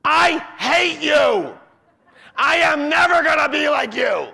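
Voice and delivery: high, loud, slow furious yell; a child yelling at mom and dad, furious; angry teen shout. Retold or imitated. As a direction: scream it at your parents like a raging kid. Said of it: "It sounds something like this".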